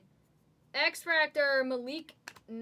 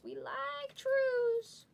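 much higher, very slow put-on voice; sillier voice; 12 semitones higher, much slower than usual. Breaker repeating the joke for viewers, even higher and slower.

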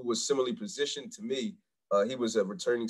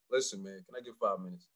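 low quiet request; 4 semitones lower, 9 dB quieter than usual. Retold or imitated. Quietly asking the director.

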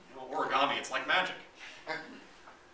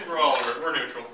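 mock India, laughing mid-line; laughing through the quote; 3 semitones lower, faster than usual. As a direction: voice India's offer while cracking up. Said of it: "India steps up and says".

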